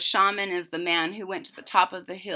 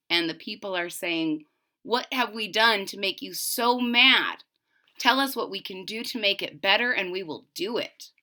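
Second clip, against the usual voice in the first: story narration; 6 dB louder than usual. A narrator's reading. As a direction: read it like a legend to a class. Teacher reads story aloud.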